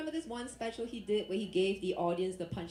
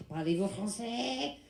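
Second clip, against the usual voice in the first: louder, drawn-out French man impression; French guy; 2 semitones higher, 4 dB louder, slower than usual. Comic plays a French guy answering her, exaggerated French voice. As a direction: put on a slow, emphatic French speaker. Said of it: "went up to this French guy".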